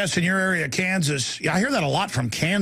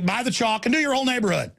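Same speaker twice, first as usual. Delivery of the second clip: ordinary talk, nothing marked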